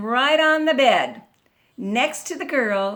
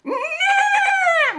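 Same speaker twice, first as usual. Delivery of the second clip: very high, loud whinny; whinny up high like a horse; horse neighing; 5 dB louder than usual. A narrator's reading. Horse joins the noisy animals, high whinny. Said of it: "went the horse".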